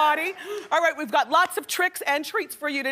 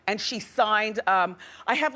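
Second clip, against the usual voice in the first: normal talking voice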